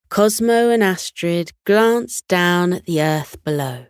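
plain reading voice

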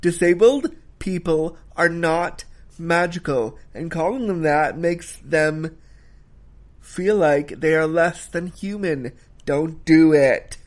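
slow, weighty emphasis, punching each point; underscored. Shown as a demonstration. Reviewer hammering home a point to viewers, slow and emphatic. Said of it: "I'm gonna underscore it this time".

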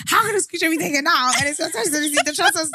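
high-pitched baffled sister; puzzled sister. Retold or imitated. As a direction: ask it like she can't believe it. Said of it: "She's like".

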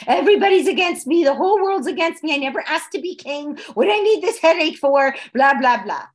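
high, loud put-upon kvetching; loud, high mock grumbling, everybody's against me; self-pitying complaint. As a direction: whine like a wronged king who wants out. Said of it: "could have easily excused himself from responsibility".

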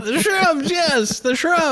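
very high mock character voice; high-pitched film quote. Fan joining in on the shrubs joke, very high mock voice. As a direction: echo the silly answer way up high. Acting out the film's shrubs line.

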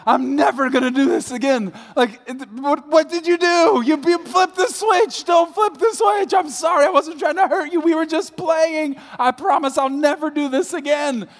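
very high, loud frantic pleading; panicked apology. Retold or imitated. Husband frantically apologizing to crying wife, high panicked pleading. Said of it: "I'm going".

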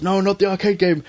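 high, dismayed cartoon pig voice; dismayed shopkeeper pig. Porkrind begging the boys not to take the arcade game, in a high dismayed voice. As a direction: plead to keep the arcade game. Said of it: "He goes".

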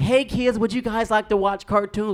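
put-on high-pitched mom addressing her kids; mock mom voice. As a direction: imitate mom with a high, singsong offer. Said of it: "My mom would walk in like".

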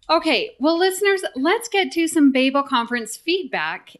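excited. Real, not acted. Energetic segment opener.

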